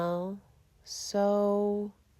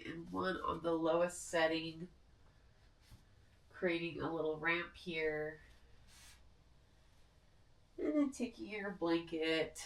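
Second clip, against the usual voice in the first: guided meditation; 11 dB quieter than usual. Acted. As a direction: ease them into rest gently. Leads final resting meditation.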